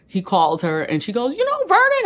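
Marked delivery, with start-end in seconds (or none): 1.3-2.0 surprised question. Retold or imitated. Voicing Serena's surprised question.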